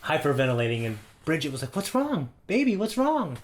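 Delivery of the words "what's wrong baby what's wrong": put-on worried girlfriend. Girlfriend worried, higher pitch.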